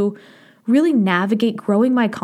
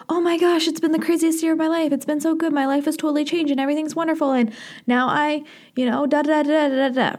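high-pitched, breezy gush trailing into da-da-da filler; mock gushing; 6 semitones higher than usual. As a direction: rattle off a rosy recap, pitched up. Imagined gushing recap of her year.

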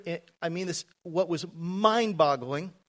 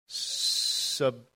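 drawn-out, stalling first syllable; mock reluctance, stuck on the first syllable; hard to say; 6 semitones lower than usual. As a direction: struggle to get the word out. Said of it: "it's hard to say".

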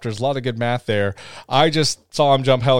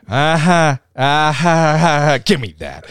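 mock taunting; 3 semitones higher, 5 dB louder than usual. Shark grabbing Woody's hat.

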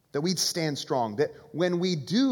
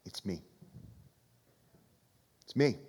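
soft Jesus quote; 9 semitones lower, 9 dB quieter than usual. Retold or imitated. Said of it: "Jesus is saying".

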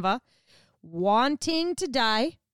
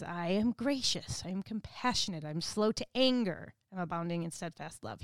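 gentle self-revelation; 4 semitones lower, 6 dB quieter than usual. Retold or imitated. God telling Moses his own character, a soft tender declaration. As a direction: let each line settle gently, soft and sure. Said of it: "And he says".

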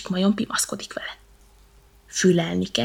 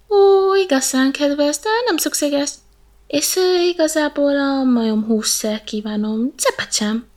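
slow, higher wry snake voice; 7 semitones higher, 5 dB louder, slower than usual. Acted. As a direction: decline politely but sourly. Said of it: "jegyezte meg fanyarul a kígyó".

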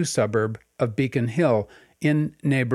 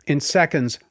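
ordinary delivery, nothing marked

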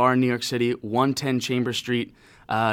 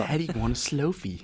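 softer echo in a put-on voice; mimicking the customer; 3 semitones higher, 4 dB quieter than usual. Friend echoes the Apple store bit, mimicking. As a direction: parrot the silly demand back, quieter.

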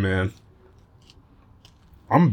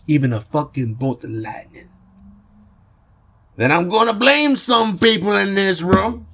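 put-on raised, theatrical Italian-accented monologue; 4 semitones higher than usual. Godfather warning the room about his son, Italian accent. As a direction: declaim it in a higher put-on Italian voice. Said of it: "but in an Italian voice".

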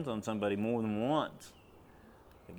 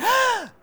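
a sudden very high, loud gasp; sharp gasp; 12 dB louder than usual. Retold or imitated. The girl as the spirit leaves her, piercing gasp. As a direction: imitate the child's gasp. Daughter's sound as demon leaves.

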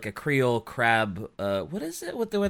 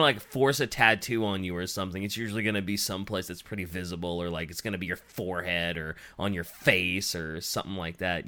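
normal talking voice

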